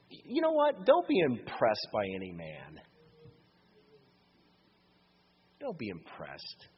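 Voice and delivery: rising preacher's call, then hushed; preaching. Acted. Preacher to congregation, urgent then hushed. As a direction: drive the point home to the congregation.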